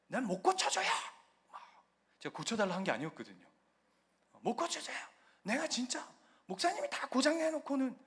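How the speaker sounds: mock angry complaint on the phone; angry landlord. Landlord yelling at the pastor over repairs, mock angry. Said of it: "버럭 막 화를 막 내시는 거예요".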